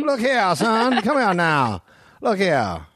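high-pitched Georgia sheriff drawl; the old Southern twang, laid on thick. Acted. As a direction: order a youngster over like a Southern lawman. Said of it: "give him the old twang twang".

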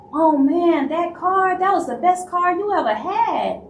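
high, loud overdone praise; mock gushing praise. Fake friend praising the totaled car, mock gushing.

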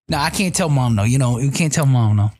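ordinary talk

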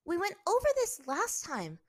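high, loud, curt refusal. A narrator's reading. Captive refusing her interrogator, curt. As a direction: refuse him sharply. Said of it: "Azula said curtly".

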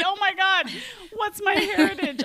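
high, loud comic panic; half-laughing imitation of being frantic; mock frantic. Podcaster replaying her texts to mom, mock frantic. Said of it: "I'm frantically texting".

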